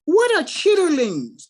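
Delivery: a friend asking, puzzled and careful; puzzled buddy. Retold or imitated. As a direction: chitterlings said slow and full. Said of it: "He said".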